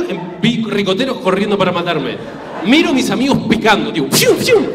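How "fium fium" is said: high, loud "fium" zooming noises; comic whoosh of people bolting; imitated whoosh. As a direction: sound effect of sprinting off. Imitates friends sprinting away.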